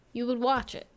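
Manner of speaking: ordinary talk, nothing marked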